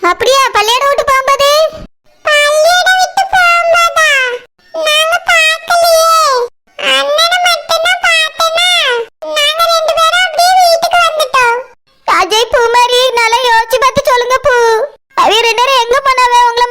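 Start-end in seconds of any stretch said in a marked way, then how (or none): 2.2-11.6 put-on child voice. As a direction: answer like a small child telling mom what happened. Child answering the mothers.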